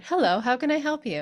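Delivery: customer service voice. Shown as a demonstration. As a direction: greet a caller cheerfully. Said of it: "Use your customer service voice".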